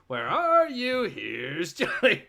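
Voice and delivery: lightly slow, drawn-out horror-movie taunt; a mild mock creepy call; Here's Johnny. Reading a chat joke, mild slow horror-movie call. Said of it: "Turns around".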